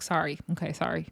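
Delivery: soft, small mock apology; a sheepish pretend sorry, hardly above a murmur; meek apology. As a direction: back down and say sorry quietly. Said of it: "just be like".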